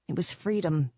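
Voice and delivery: usual speaking voice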